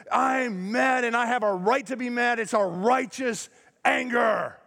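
high-pitched, self-justifying mock outrage; mock righteous anger. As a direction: proclaim your anger as if entitled to it. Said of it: "tempted to defend your anger".

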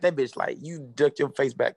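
ordinary talk, nothing marked

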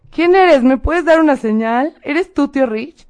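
high, slow, drawn-out questions to an unseen presence; calling to spirit. Retold or imitated. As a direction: act out calling to a ghost, starting loud. Acting out calling to uncle's spirit.

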